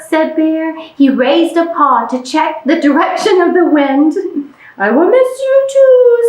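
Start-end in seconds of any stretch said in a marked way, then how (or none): 4.8-6.2 higher duck voice, a touch sad; a small duck saying goodbye. Acted.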